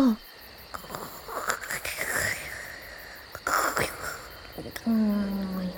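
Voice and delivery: breathy unvoiced hmm, very soft; whispered hmm. Real, not acted. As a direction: think it over in a hushed breath. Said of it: "pondering sound, whispered". (息だけでささやく「うーん」)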